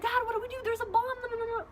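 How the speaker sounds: high, alarmed plea; panicked. Retold or imitated. Daughter calling her dad at four am, high and alarmed. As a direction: ask like a frightened kid.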